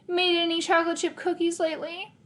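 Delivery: high, teasing sister jab; lightly voiced snide sister; mocking jab. A narrator's reading. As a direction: twist the knife with a sweet tease. Sister mocking his baking.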